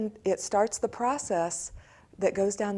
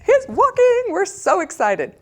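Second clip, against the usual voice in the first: much higher and louder, gushing with excitement; thrilled adults; 13 dB louder than usual. Retold or imitated. Adults cheering a toddler's first steps, high excited gushing. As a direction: squeal it like a proud parent. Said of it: "the adults are like".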